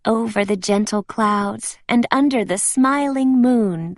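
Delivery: ordinary delivery, nothing marked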